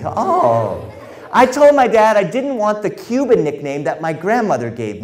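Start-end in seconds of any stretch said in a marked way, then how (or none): none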